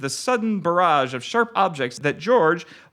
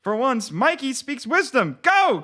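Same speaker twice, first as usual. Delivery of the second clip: high panicked yelling; shouting; 11 semitones higher than usual. Acted. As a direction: shout a frantic warning.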